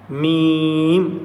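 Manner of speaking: میم hummed long through closed lips; drawn-out mim demo. Shown as a demonstration. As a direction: hold میم slowly with both lips together. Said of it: "دونوں ہونٹ ملتے ہیں".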